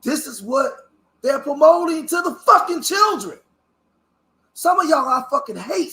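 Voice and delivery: high, loud angry yell; real outrage, shouting; furious shout. Real, not acted. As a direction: explode at the people who don't care. Furious rant about children's trauma.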